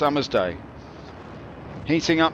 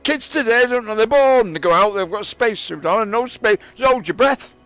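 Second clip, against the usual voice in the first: put-on grumpy old-timer; 5 semitones higher, 5 dB louder than usual. Old man grumbling to the kids about the old days, loud and high. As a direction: rant like a cranky granddad who had it harder.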